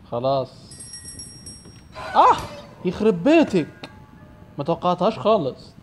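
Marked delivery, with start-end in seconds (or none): 2.2-3.9 startled exclamation. Real, not acted. Startled by trap.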